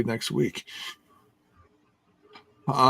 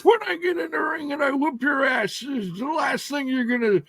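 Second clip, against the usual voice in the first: mock wrestler promo; 3 dB louder than usual. Acting a wrestler's threat promo.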